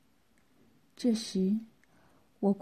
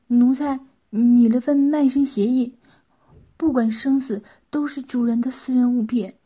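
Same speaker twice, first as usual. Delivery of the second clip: louder, earnest formal pledge; obedient servant, earnest; 2 semitones higher, 7 dB louder than usual. Acted. A servant presents a document to the master, earnest and loud. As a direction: present it dutifully, voice projected.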